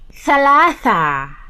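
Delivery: ثلاثة said fast and punchy, loud; model word for repeating. Shown as a demonstration. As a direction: snap out ثلاثة for kids to copy. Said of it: "Can you say it with me?".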